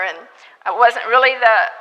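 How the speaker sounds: usual speaking voice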